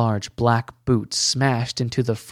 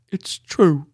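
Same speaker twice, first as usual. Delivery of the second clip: higher, louder choked admission; 4 semitones higher, 5 dB louder than usual. A narrator's reading. Bertrand admitting the blame, choking up. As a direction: force it out past a lump in the throat. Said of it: "he choked".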